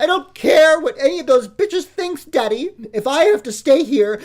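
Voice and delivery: high, loud screaming cry of rage; screaming tantrum. Acted. Spoiled daughter screaming at Daddy in a tearful tantrum.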